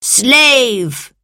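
loud, high summons to a servant; yell. A narrator's reading. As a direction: yell imperiously across the hall, drawn out and falling. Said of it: "he yelled".

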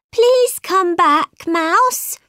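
high, slow imploring call; pleading. A narrator's reading. Alice calling after the offended mouse, high plea. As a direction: plead with him to return, pitched high.

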